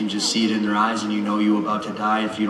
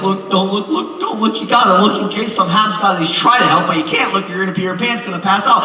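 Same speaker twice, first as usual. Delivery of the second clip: panicked dad; 7 semitones higher than usual. Retold or imitated. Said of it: "I'm like".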